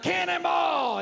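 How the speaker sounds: high, slow, excited yell; shouted cannonball call. Retold or imitated. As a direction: yell it like jumping in. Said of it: "and you're like".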